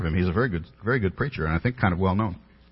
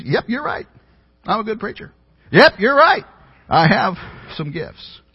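higher, sing-song smug bragging; mock pompous boast; 11 semitones higher, 3 dB louder than usual. Pastor mocking how the evangelist seemed to brag, smug. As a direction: ham up a preacher full of himself. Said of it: "How pompous".

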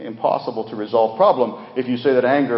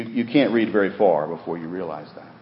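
usual speaking voice

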